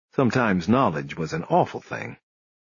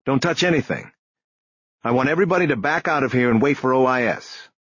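brisk, higher-pitched command; Bosch, barked order; 4 semitones higher, faster than usual. A narrator's reading. Detective ordering everybody out of the crime scene, mildly barked. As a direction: take charge with a mild bark. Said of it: "Bosch barked".

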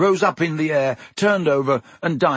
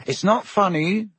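higher, indignant protest; fierce; 8 semitones higher than usual. A narrator's reading. Ron objects to Hermione giggling at him, fierce. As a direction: snap back fiercely, pitched up. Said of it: "said Ron fiercely".

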